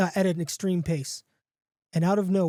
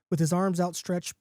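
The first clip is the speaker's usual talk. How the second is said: ordinary delivery, nothing marked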